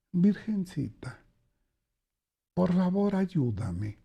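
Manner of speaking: soft, slow pleading prayer to the Virgin; desperate father praying in a quiet church; hushed prayer. Acted. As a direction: beg the Virgin softly.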